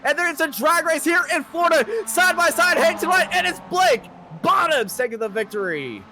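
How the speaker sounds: rising, frantic play-by-play shout; excited race call. Real, not acted. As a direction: call the finish at full voice.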